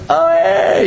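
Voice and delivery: high-pitched mock "oh, hey" of recognition; high, playful imitation of a startled hello; imitating surprised greeting. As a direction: imitate someone suddenly noticing a friend, voice jumping up. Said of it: "he goes like this".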